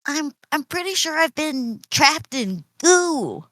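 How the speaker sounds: high-pitched character voice; [name]'s plant-speech voice. Acted. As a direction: talk high to your plant friend. [name] answers Bud in plant speech.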